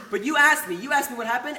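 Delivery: "you asked me" pitched high and punched; emphatic contrast. Retold or imitated. Acted speech contrasting nobody with you.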